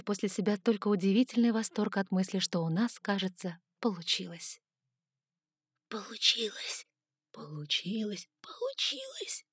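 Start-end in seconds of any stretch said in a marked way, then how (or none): 5.9-9.5 eager repeated whisper. Acted. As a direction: whisper it, rising with excitement. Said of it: "шептали кости".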